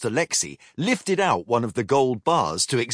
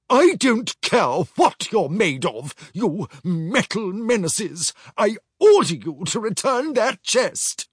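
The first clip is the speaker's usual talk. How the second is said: higher, louder mock yell; mild yell; 8 semitones higher, 3 dB louder than usual. Commander orders pirates to return chest, mild yell. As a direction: order them like an affronted officer. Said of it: "yelled the commander".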